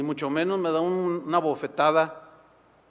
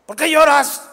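annoyed husband; 8 semitones higher, 9 dB louder than usual. Acted. Husband questions his crying wife.

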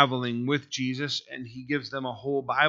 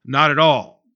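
much louder, all falling hard; emphatic shout; 9 dB louder than usual. Real, not acted. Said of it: "That's not what's being said".

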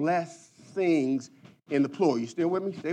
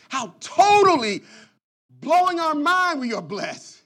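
higher, louder, slow swelling cry of praise; climactic preaching cry; 10 semitones higher, 6 dB louder than usual. Real, not acted. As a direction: lift the congregation with a rising shout.

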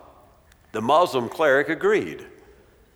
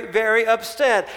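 usual speaking voice

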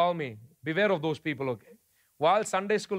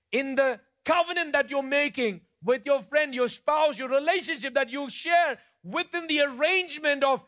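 higher, ringing preacher's call; preaching at full force; 6 semitones higher than usual. Acted. Pastor urging the congregation toward faithfulness, in full preaching voice. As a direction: drive the point home to the congregation.

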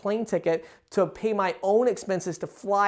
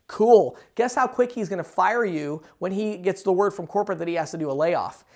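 usual speaking voice

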